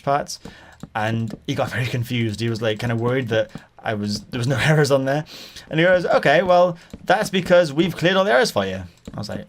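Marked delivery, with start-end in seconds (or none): 6.0-8.8 higher, louder mock Indian accent. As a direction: mimic an Indian-accented caller covering himself. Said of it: "he definitely had an Indian accent".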